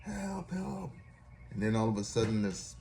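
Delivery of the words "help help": very high, thin-volume cry for help; mild mock scream. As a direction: imitate a hopeless scream, held back. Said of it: "He was screaming. He was giving up hope".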